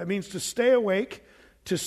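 ordinary talk

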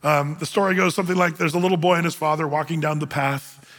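ordinary talk

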